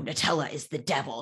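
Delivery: ordinary talk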